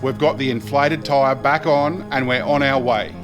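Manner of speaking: ordinary talk, nothing marked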